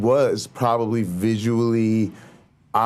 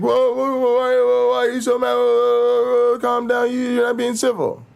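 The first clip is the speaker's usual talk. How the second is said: much higher, mock-fretful falsetto; mocking imitation; 14 semitones higher, 3 dB louder than usual. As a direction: parody people scolding someone for losing their temper. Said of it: "And then everybody's like".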